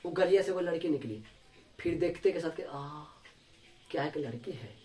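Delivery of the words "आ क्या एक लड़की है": hushed, wondering exclamation; softer voice, caught by surprise; soft amazed gasp. Retold or imitated. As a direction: breathe it out in awe. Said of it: "हम देख करके मजा आश्चर्य हो गए".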